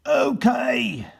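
high shouted okay. Acted. Turkey catcher Captain Bill answering the governor with shouted okay. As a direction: bark out a keen okay. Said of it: "said Captain Bill".

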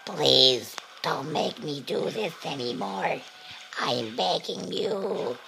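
slow, strained mock pleading; hammed-up exhausted plea; voice dying. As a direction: drag out the begging on a worn-out voice. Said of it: "My voice is dying from this".

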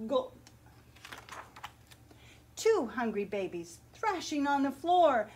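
put-on lively picture-book read-aloud for children; story narration. A narrator's reading. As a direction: read it like story time at the library.

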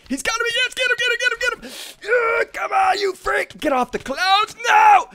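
high-pitched urgent shouting; high, worked-up gamer hollering; frantic battle yelling. Real, not acted. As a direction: yell wildly after the escaping enemy. Frantic attack on boss.

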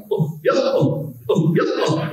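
high three-part Goofy laugh imitation; imitating Japanese Goofy laugh. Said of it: "it sounds more like".

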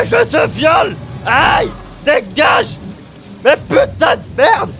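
high, very loud defiant screaming; frantic full-force cursing; enraged yelling. Real, not acted. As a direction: scream back at the attacker. Yelling back at the boss.

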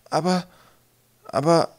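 high, halting false starts; stammering. A narrator's reading. Twig defending himself to the trolls, stammering. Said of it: "stotterte Twig".

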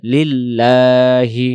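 লিল্লাহি with the লা held one beat, slow; one alif stretch. Shown as a demonstration. Teacher showing the stretch on লা. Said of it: "এক আলিফ টানবো".

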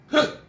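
sudden squeaky hiccup; a real hiccup breaks through. Real, not acted. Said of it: "I'm fighting the hiccups".

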